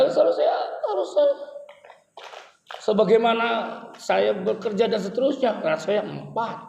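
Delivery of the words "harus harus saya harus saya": laughing high protest. Real, not acted.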